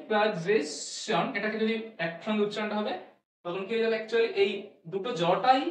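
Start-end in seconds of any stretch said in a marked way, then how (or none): 0.1-1.3 সাজেশন said very slowly, syllable by syllable; slow pronunciation demo. Shown as a demonstration. Teacher joining sounds into one word, very slow. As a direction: stretch সাজেশন out slowly like a model. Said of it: "এবার যদি এটাকে একসঙ্গে উচ্চারণ করি".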